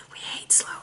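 breathy confiding whisper. Real, not acted. Leo reader confiding to Leo viewers in whisper. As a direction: whisper it like a guilty secret.

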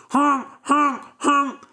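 high loud honking; goose honk. Acted. Goose honks as Jack steals it, high honking.

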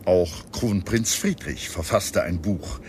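ordinary talk, nothing marked

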